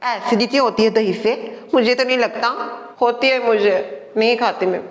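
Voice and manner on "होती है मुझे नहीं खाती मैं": ordinary talk, nothing marked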